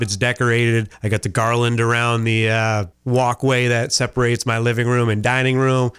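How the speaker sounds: normal talking voice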